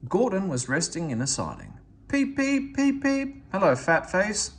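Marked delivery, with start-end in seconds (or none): 2.1-3.4 high piping peep peep; high, far-off engine whistle; cheeky whistle. A narrator's reading. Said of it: "whistled Henry". 3.5-4.5 cheeky. A narrator's reading. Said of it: "whistled Henry".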